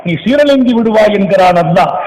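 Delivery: ordinary talk, nothing marked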